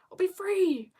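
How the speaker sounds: high, sing-out delight; lifted, happy exclamation; gleeful. Real, not acted. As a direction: celebrate coming freedom. Looking forward to a week off.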